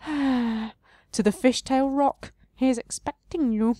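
high, slow, sighing tree spirit; sorrowful tree voice. Acted. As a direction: intone it slowly like an old tree. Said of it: "sighing a deep sorrow".

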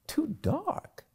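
soft, high rising question; incredulous echo. Acted. Marilla doubting Anne's excuse about the dark, incredulous.

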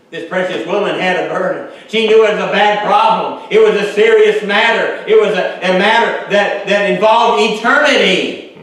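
loud, high preacher's cry; fervent preaching. Acted. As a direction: preach it out at full force. Preaching at full force.

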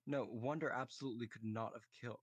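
usual speaking voice

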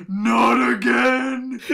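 loud, high, drawn-out mock panic; imitating scared cry. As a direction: wail it out as if terrified. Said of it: "He sounded really fucking scared".

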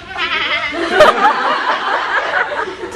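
drawn-out cackling giggle; long laugh. Acted. Host teasing guest about his abs, long laugh.

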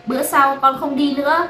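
high-pitched, peeved retort; annoyed. Acted. Daughter retorts to scolding father, annoyed. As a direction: answer back in a huff. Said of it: "Nói bằng giọng bực dọc".

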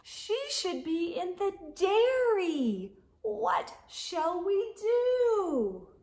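sing-song the refrain for little ones; storybook refrain. A narrator's reading. Reader asking the children what to do, sing-song.

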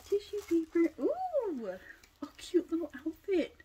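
high, delighted gush over the outfit; excited squeal. Real, not acted. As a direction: coo with delight at the find. Excited unboxing reveal.

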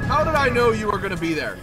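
loud, high cry of exasperation; exasperated shout. Real, not acted. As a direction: cry out high, caught in an ambush. Ambushed and hit in game.

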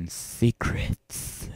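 loud, slow, hissed relish; mock-creepy relish. Streamer teasing viewers about their secrets, mock-creepy relish. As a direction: draw it out loudly with creepy glee.

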